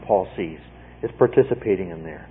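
ordinary talk

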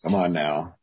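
ordinary delivery

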